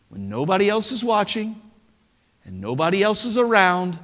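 usual speaking voice

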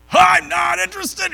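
high, loud curt brush-off; mock rejection. Householder shouting him away from the door. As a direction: snap it like a door slam.

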